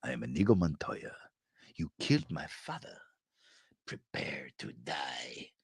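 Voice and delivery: menacing build down to a whisper; Inigo Montoya line. Retold or imitated.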